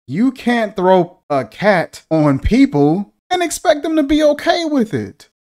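high-pitched, climbing exasperation; indignant protest. Real, not acted. Critic protesting the cat thrown at girls, indignant. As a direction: protest loudly, rising into a squeak.

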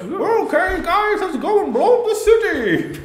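high Bane voice, hand over mouth. Retold or imitated. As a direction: muffle it behind your hand like Bane. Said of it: "Wanna put your hand over your mouth a little bit?".

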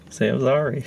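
ordinary talk

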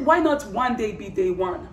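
normal talking voice

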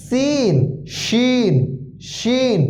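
slow demonstration of শিন; heavier shin. Shown as a demonstration. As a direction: lean into a heavy শিন. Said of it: "একটু ভারী শব্দ".

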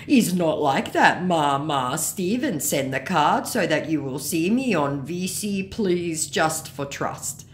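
mock a begging scammer, trailing out slowly. Scammer begging Ma to send the card, mock pleading.

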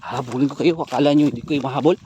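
loud, challenging taunt; taunting them loudly while chasing. Real, not acted. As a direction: shout a threat after them. Taunting the fleeing creatures.

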